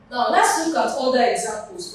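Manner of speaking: ordinary delivery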